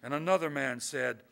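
measured, projected poem recital; poetry reading. A narrator's reading. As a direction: read the poem out to a room, pausing between phrases. Reads a poem aloud to audience.

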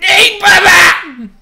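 very high, very loud, stretched shout; screamed echo of a friend's call; drawn-out yell. Real, not acted. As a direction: holler it slowly at full volume. Yelling back the other player's call.

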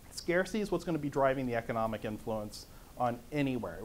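ordinary talk

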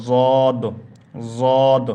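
জদ said loud and heavy, tongue touching back teeth; loud classroom letter drill; letter demo. Shown as a demonstration. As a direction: model জদ clearly for the class. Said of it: "চতুর্দিকে লেগে এটা উচ্চারণ হবে".

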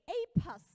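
"A plus" punched high and loud; amazed emphasis. Real, not acted. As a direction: hit "A plus" hard as the surprising detail. Amazed report of exact blood group.

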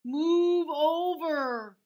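loud, very slow bossy cow order; demanding cow. A narrator's reading. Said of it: "uttered Cow".

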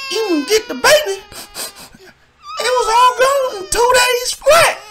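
very high, wailing put-on voice; sobbing addict. Chuck Wagon sobbing about losing the bricks, wailing high. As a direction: wail it out like a blubbering junkie.

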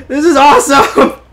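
loud, high, elated; exhilarated and high-pitched; thrilled shout. Real, not acted. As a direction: shout it with giddy delight. Thrilled by the chase.

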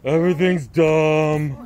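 put-on slow, loud bored teen whine; teenager voice. Shown as a demonstration. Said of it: "Let's hear your teenager voice".

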